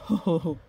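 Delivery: short acted "ho ho" laugh; blacksmith's laugh. A narrator's reading. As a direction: give the blacksmith's brief put-on chuckle. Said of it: "The blacksmith laughed".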